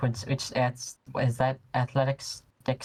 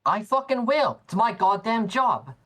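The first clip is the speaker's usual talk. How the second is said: pitched up, defiant retort; 7 semitones higher than usual. Acted. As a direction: throw it back in his face.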